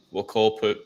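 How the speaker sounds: ordinary talk, nothing marked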